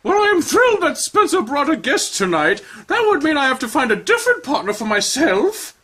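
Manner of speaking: high, theatrical grande dame; high showy dance teacher; Madame Esperanza. Acted. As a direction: gush like a flamboyant instructor. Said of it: "Madame Esperanza voiced".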